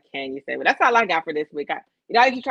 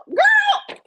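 high, loud mock wail of "Girl!"; a big dramatic cry; mock outrage shout; 8 dB louder than usual. Reacting to [name] news.

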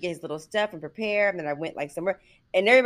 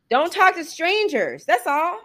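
higher, slowed, louder parental warning; mock stern warning; 12 semitones higher, 6 dB louder than usual. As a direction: lecture them like a worried producer. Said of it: "what was my producer saying".

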